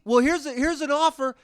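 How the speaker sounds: high, loud desperate offer; begging. Acted. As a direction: scramble to keep the customer. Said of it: "they beg you to".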